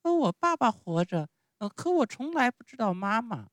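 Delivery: put-on very high puppet-child voice; innocent little Pinocchio. Pinocchio answers about his parents, high and childlike.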